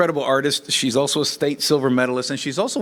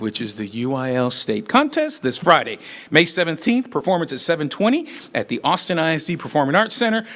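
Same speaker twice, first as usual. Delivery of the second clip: ordinary talk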